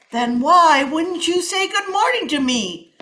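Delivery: loud, accusing question; demanding snake. Acted. Snake confronts the iguana, loud and accusing. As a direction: press him hard. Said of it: "demanded the snake".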